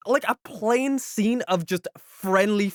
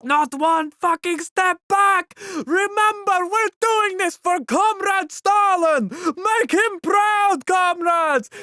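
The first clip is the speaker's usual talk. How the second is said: high, slow battle-cry bellow at the troops; rally the comrades with a big, drawn-out yell; shouting Russian commander; 13 semitones higher, 5 dB louder, slower than usual. Retold or imitated. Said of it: "one of the Russian commanders being just like".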